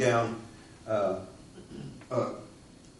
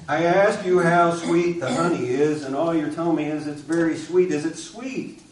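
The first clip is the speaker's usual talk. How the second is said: louder, higher exasperated demand; frustrated man; 5 semitones higher, 7 dB louder than usual. Acted. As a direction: lay into the kid, fed up. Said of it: "you're starting to frustrate me".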